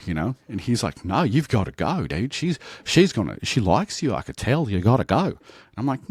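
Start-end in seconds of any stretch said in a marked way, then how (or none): 1.0-5.4 higher, insistent mock Aussie; imitating a pushy Australian mate; fake Australian accent. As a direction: urge him on in a hammed-up Australian accent. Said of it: "talking to her in the Australian accent".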